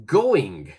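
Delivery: "going." with full final G. Shown as a demonstration. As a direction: say the full word first. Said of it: "For example".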